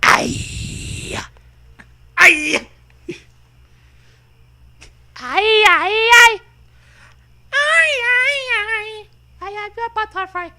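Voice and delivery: very high, loud squealing creature voice; shrill rodent shrieks, then a fast high line; squeaky rodent king. Acted. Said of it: "the king of rodents whisper in your ear".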